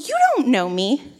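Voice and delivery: indignant inner rant, pitched high; seething. Retold or imitated. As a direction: protest your innocence in mock indignation. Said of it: "I'm sitting there seething".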